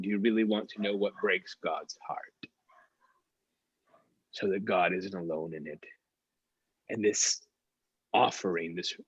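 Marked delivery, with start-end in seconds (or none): 0.0-5.8 hushed whispered question. Real, not acted. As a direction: lean in and whisper it to the congregation. Preacher's intimate question.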